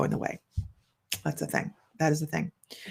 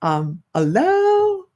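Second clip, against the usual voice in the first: surprised hello; 9 semitones higher, much slower than usual. Real, not acted. Surprised by a viewer's comment.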